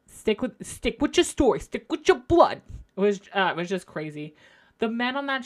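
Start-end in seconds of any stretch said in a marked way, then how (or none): 0.1-2.6 starts plain and rises to a loud, higher snap; building retort. Retold or imitated. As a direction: push it harder with each repeat until it bursts out. Rachel snapping back at Teresa.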